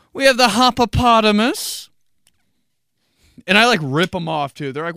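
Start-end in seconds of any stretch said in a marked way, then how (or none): 0.1-1.9 high, loud announcing voice with rising end; server reciting beers. Acted. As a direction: announce a silly beer name to the table. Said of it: "saying I P As to people".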